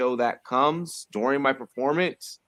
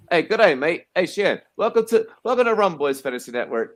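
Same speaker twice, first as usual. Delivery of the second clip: put-on playful Australian accent, pitched higher and louder; 6 semitones higher, 5 dB louder than usual. Host greeting his guest in a mock Australian accent. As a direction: greet the guest like a cheery Aussie. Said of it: "how's my Australian accent?".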